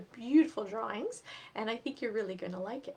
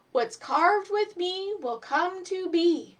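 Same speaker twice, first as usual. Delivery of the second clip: slow, higher, louder spell reading; magic incantation; 8 semitones higher, 7 dB louder, slower than usual. Acted. Eddie reads magic words.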